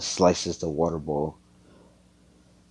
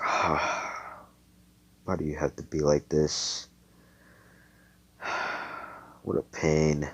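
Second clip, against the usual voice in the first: sighing, softer complaint; weary exasperation; 3 semitones lower, 7 dB quieter than usual. Acted. Austin gives up arguing with her, weary exasperation. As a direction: sigh it out, fed up.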